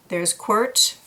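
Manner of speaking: plain reading voice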